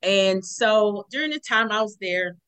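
normal talking voice

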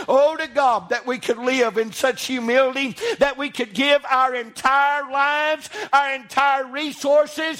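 preaching climax. Acted. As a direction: build it up to a crying-out peak.

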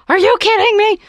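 high, loud exasperated outburst; incredulous and fed up, voice jumping high. Retold or imitated. Beast to Belle over his rose, high loud disbelief. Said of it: "And he's like".

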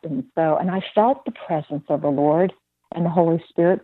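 normal talking voice

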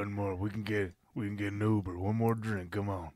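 quiet, low pushy drinking buddy; low coaxing voice. Pretend.

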